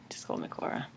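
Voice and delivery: hushed, almost whispered "Just call me Cora"; soft quoted aside. Retold or imitated. Telling people her nickname, in a soft hush. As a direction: drop to a breathy hush for the quote. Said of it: "I just say".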